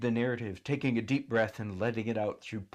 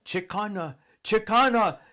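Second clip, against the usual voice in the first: calling out; 10 semitones higher, 7 dB louder than usual. A narrator's reading. People calling out at the sky.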